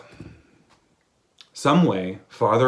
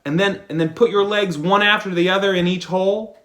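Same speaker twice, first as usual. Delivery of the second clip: higher, louder advice; mildly raised advising voice; lightly voiced lover; 8 semitones higher, 7 dB louder than usual. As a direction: offer the fix with a throat clear. Lover advises trouser man.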